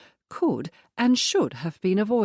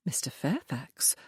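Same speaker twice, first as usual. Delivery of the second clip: hushed; 3 semitones lower, 8 dB quieter than usual. A narrator's reading. Teasing friend, hushed aside.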